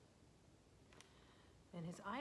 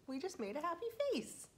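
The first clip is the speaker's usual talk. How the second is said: cheery; 10 semitones higher, 6 dB louder than usual. Real, not acted. Teacher cheering a finished face.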